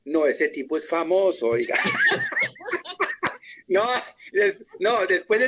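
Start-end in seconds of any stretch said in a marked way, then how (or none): 1.8-3.3 laugh. Real, not acted. Amused at the joke.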